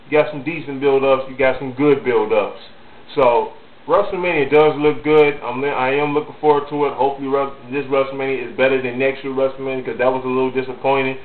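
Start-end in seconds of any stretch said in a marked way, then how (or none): none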